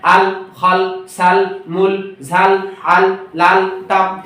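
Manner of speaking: very slow, each word set apart; slow word-by-word reading for children; slow reading demo. Shown as a demonstration. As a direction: read each word slowly and clearly. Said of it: "তখন এভাবে বলবেন".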